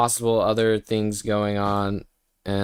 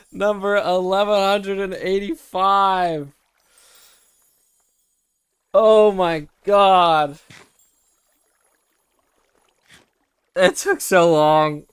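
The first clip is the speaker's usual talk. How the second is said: high and loud, slow elated words; giddy relief; 8 semitones higher, 7 dB louder, slower than usual. Real, not acted. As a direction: savour the long-awaited win. Still overjoyed after the catch.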